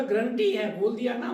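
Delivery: loud, high, insistent guarantee; emphatic assurance. Retold or imitated. Assuring the failing officer he will pass, loud and emphatic. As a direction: promise it loudly so he believes.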